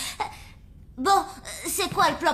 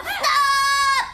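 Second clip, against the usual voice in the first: « Stop ! » crié plus haut; cri pour arrêter; 6 semitones higher, 4 dB louder than usual. Acted. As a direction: coupe court en criant. Interrupts the laughing pair. (elle crie pour faire taire les rires)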